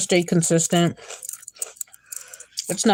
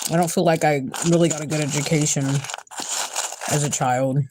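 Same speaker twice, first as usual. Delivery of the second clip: talking while crunching snacks; chewing between words, mouth full; crunching chips; 4 dB quieter than usual. Real, not acted. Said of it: "Sorry about all the crunching".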